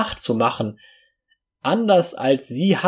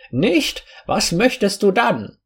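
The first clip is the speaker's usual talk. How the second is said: high, lightly feigned innocence; mock-innocent questions in a higher voice; playing innocent; 6 semitones higher than usual. A narrator's reading. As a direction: ask as if you had no idea, pitched up. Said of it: "Ich spielte die Unschuldige".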